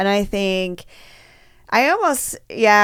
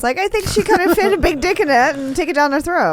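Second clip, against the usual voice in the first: excited gush; 9 semitones higher than usual. Real, not acted. Excited gush over photo.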